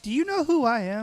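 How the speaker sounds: puffed-up celebrity demanding recognition; mock indignant who-do-you-think-I-am; mock arrogant star. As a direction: play the self-important big shot. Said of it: "I wanna be able to use that phrase somewhere".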